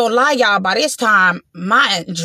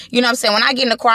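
normal talking voice

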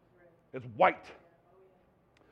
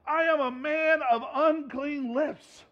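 slow, higher lament; slow confession; 4 semitones higher, slower than usual. Acted. Isaiah confessing his unclean lips, slow lament. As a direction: confess it slowly in shame.

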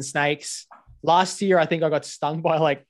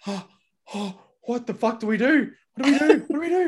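rising higher with each "what do we do"; mock panic; 7 semitones higher than usual. Retelling the wasp nest on the boat, building mock panic. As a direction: spiral into playful panic.

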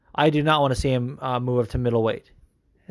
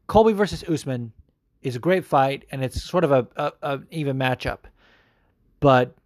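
ordinary talk, nothing marked

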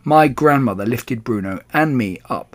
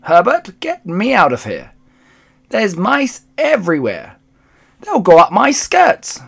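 shrill, high-pitched screaming; Missus Jenkins; 8 semitones higher, 6 dB louder than usual. A narrator's reading. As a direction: screech it in hysterical panic. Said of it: "it was screaming".

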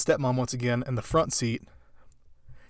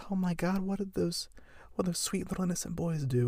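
grandmas gasping; 5 semitones higher, 9 dB quieter than usual. Retold or imitated. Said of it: "they're just like".

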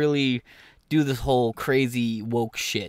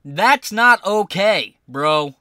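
loud protest; 4 semitones higher, 6 dB louder, much slower than usual. Real, not acted. Streamer objecting to clip.

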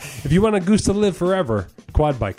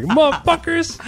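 much higher squeaky goose curse; squawking goose voice; 14 semitones higher, slower than usual. Retold or imitated. Goose cursing at the friend, high squawking voice.

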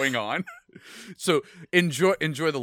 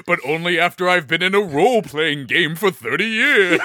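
unearthly voice; 7 dB louder than usual. Pretend. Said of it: "demon god".

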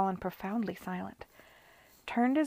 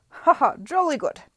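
loud high laughing cheer; hearty laugh; 7 semitones higher, 14 dB louder than usual. Acted. Arthur Parker laughing loudly at Miss Lamb's joke. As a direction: guffaw with amused admiration.

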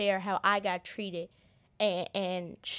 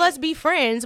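mock friendly line; 7 semitones higher, 9 dB louder than usual. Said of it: "that's how I come off".

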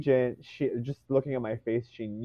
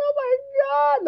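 much higher, a laughing teary squeal; crying in disbelief; 3 dB louder than usual. Retold or imitated. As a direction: squeak it out through happy tears. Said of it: "I start crying".

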